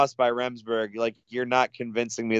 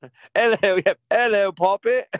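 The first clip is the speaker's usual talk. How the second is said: hammed-up Cockney, higher and brighter; mock British accent; 8 semitones higher, faster than usual. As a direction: put on a jokey London geezer voice. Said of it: "with a British accent".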